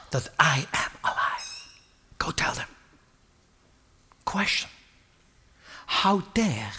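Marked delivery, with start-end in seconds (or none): 0.1-2.7 whisper. Acted. Jesus sending Mary to his brothers, whispered. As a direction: say it like a tender secret.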